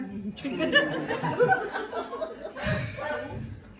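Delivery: high giggles; high giggling. Real, not acted. Laughs at the banana bit.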